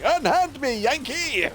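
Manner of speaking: high, shouted Bart Simpson protest; staged battle cries. Retold or imitated. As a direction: yell it like a dragged-off kid. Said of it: "him just shouting".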